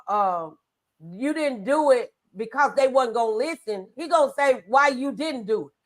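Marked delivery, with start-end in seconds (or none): none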